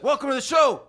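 high, loud excited welcome; excited shout. Shown as a demonstration. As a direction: yell the welcome, pumped up. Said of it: "I get excited, and I'll be like".

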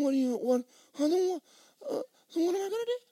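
very high, fast, near-crying; panicked crying protest. Retold or imitated. Preacher panicking after God refuses, near tears. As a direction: voice breaking in panic.

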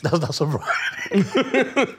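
slow, high, laughing protest; laughing disbelief. Real, not acted.

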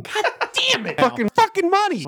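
high-pitched yelled cursing; real yelling. Real, not acted. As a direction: curse at the top of your pitch. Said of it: "Sorry, I'm yelling".

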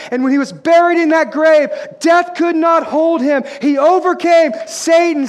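shouted full-force gospel preaching; high, loud and slow, hammering each phrase; Peter. Acted. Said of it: "raises up his voice".

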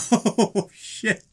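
high laughing burst into a swear; shocked laugh at the insult; laugh then oh shit. Real, not acted. Laughing reaction to a knockout threat.

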